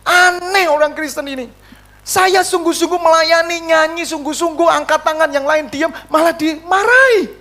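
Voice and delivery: high-pitched mock indignation; acting out wounded outrage; retold anger. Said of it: "waktu itu saya marah".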